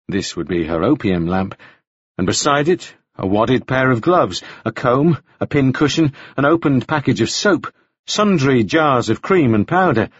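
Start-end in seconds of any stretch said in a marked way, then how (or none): none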